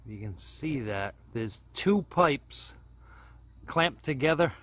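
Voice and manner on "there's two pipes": usual speaking voice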